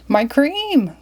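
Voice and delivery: mild mock dismay; Mister Flapsaddle cries. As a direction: cry out for the cream. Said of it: "cried Mister Flapsaddle".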